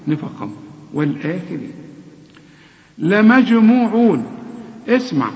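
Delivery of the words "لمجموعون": لمجموعون with the jim bouncing; slow recited demo; jim qalqala. Shown as a demonstration. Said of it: "كل جيم ... قلقلة".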